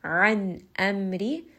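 an amri slow, the hamza given a sharp little stop. Shown as a demonstration. Teacher demonstrating a sharp hamza, slow recitation. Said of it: "giving a little bit of sharpness".

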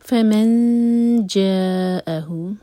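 slow, loud فَمَنْ جَاءَهُ with nasal hidden نون; ikhfa recitation. Shown as a demonstration. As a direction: chant فَمَنْ جَاءَهُ, long جا. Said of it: "جب اخفا کریں تو دو seconds کا ٹائم".

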